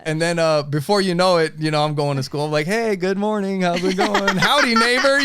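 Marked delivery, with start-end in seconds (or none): 2.7-4.4 1 mock cheerful greeting. Now friendly to neighbor. 4.4-5.2 high, loud mock cheerful howdy; laughing through an exaggerated folksy greeting; hammed-up friendly. Mocking his new friendliness.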